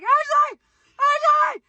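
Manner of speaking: high, loud yell for help; re-enacted screaming. Retelling calling her sister upstairs, re-enacted screaming. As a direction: scream up the stairs to your sister, acted out. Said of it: "I literally was screaming, and my sister was upstairs".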